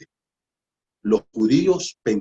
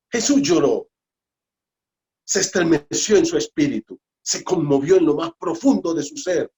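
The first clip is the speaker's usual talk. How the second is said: louder, driving preaching voice; fervent preaching; 4 semitones higher, 4 dB louder than usual. Real, not acted. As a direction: keep pressing the point with force. Preaching continues loud.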